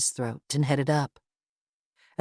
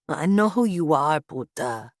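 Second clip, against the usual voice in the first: menacing thug. A narrator's reading. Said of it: "the man in the werewolf costume said".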